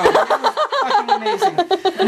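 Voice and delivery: high, laughing gush of delight; laughing rave. Real, not acted. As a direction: squeal it out through laughter. Raving about the battle.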